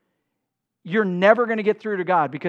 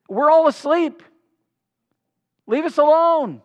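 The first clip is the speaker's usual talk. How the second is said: raised, higher and louder shout; calling out rebuff; 7 semitones higher, 6 dB louder than usual. Acted. Sleeper inside answers a neighbor at night, calling out crossly. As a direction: call out loudly to send someone away.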